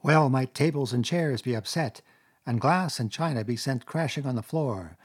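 plain reading voice

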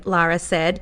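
usual speaking voice

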